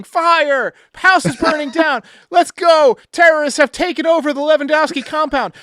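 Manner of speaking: high, loud panicked yelling; hammed-up emergency shouting; mock alarm scream. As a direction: shout like the house is on fire. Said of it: "she didn't know if I was screaming".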